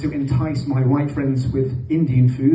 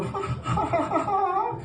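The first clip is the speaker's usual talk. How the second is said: high mock laughter; 6 dB quieter, slower than usual. Kids laughing at dad.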